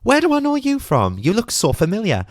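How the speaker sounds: high-pitched imitation of a speaker's greeting; Fern Cotton. Speaker on stage to audience questioner, high puzzled recognition. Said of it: "Fern went".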